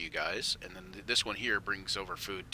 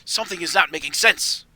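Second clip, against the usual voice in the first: raised, exasperated insistence; acted exasperation; frustrated; 8 semitones higher, 12 dB louder than usual. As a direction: push it out higher and louder, frustrated. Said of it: "seems to be getting frustrated".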